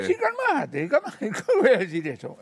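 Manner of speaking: amused mockery of the naive reassurance; mocking laugh. Real, not acted. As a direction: scoff at the idea through laughter.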